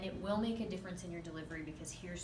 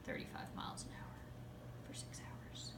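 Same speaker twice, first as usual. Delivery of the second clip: whisper; 12 dB quieter than usual. Real, not acted. Aside about slow chase.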